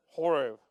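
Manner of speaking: Horeb with an H up front and a clear r. Shown as a demonstration. Pastor tries Horeb the congregation's way. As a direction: breathe out the H on Horeb. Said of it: "You gotta do the H there".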